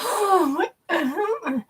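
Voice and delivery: high, breathless gasp of disbelief; stunned shock. Retold or imitated. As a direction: blank shock, voice jumping up. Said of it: "I went brain dead".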